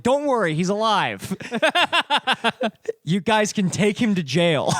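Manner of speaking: mock reassuring Batman. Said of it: "he's like".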